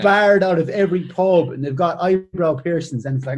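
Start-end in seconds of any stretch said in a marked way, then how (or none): none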